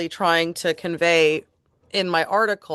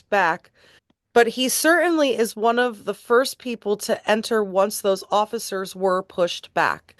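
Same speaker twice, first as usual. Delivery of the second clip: measured, emphatic reading of a judge's remarks; formal read-aloud; 4 semitones higher than usual. Acted. Reading a judge's sentencing remarks to listeners, formal and deliberate. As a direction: read it out like a court statement, clearly projected.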